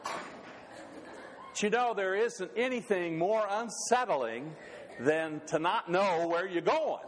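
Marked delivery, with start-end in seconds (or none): 0.0-1.6 real laughter. Real, not acted. Laughs at own joke.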